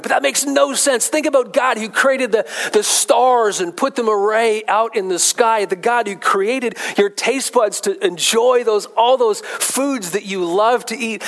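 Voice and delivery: high-pitched, emphatic sermon build; fervent preaching. Real, not acted. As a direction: drive the point home with stretched, high stresses. Preaches with rising fervour.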